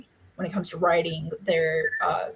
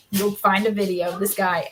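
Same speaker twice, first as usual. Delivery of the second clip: usual speaking voice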